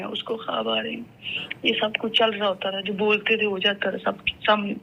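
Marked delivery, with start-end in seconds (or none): 0.0-1.0 a quiet, lower echo of the line; hushed repeat. Retold or imitated. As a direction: lower and softer, "اس کو خواب آ رہے ہیں" dropped to a murmur.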